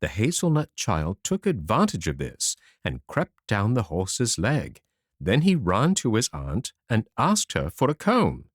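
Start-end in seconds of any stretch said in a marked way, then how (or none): none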